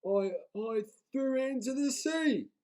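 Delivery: high stammering troll, scared; frightened troll owning up; nervous troll. A narrator's reading. Timid troll.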